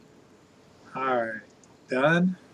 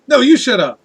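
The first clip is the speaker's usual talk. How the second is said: loud comeback; 8 semitones higher, 8 dB louder than usual. Pretend. Arguing with self, comeback.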